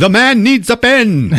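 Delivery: high, loud, slow proclamation; grandiose mock demand. Inspired writer needs a pen, hammed-up urgency. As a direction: bellow it slowly in a high voice.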